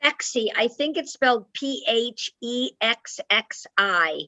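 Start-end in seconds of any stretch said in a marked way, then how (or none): 1.5-4.2 slow, separated letters for someone noting it down; careful slow spelling on request. Shown as a demonstration. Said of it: "Can you say that slowly again".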